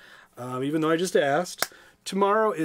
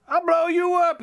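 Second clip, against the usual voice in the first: much higher and louder threatening cry; villain threat; 12 semitones higher, 4 dB louder than usual. Acted. Van Itch threatening Grandpa, shrill and loud. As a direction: threaten him with a sudden shrill blast. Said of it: "he said".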